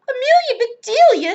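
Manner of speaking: loud, high cry of the maid's name; dismayed cry. A narrator's reading. Missus Rogers sees the bowl, crying out. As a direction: cry out in horror at her handiwork. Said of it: "she cried".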